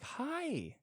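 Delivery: very high, sugary greeting; mock overly sweet hello; fake friendly. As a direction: chirp it with forced cheer. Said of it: "you have to be like".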